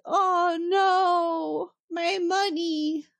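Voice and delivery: high, drawn-out wail of dismay; mock devastation. Treasure hunter watches her prize blow up, mock devastated. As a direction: play a hammed-up devastated lament. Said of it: "She's devastated".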